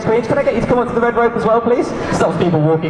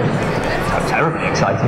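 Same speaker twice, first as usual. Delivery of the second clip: mock posh; 9 semitones lower, slower than usual. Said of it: "It's very posh, isn't it?".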